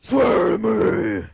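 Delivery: strained, slow Batman growl; gravelly voice. Retold or imitated. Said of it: "a gravelly voice".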